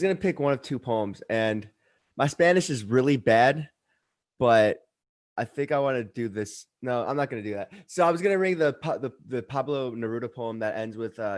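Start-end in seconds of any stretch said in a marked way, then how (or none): none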